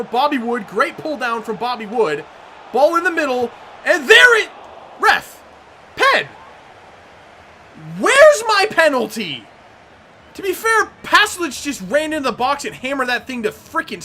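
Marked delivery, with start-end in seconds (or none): 5.0-9.4 slow, louder, indignant ask; drawn-out frustrated appeal; angry demand. Real, not acted. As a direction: demand the penalty from the ref. Denied a penalty.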